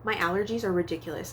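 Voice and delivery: ordinary talk, nothing marked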